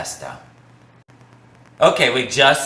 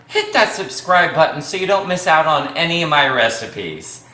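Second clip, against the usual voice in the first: ordinary talk, nothing marked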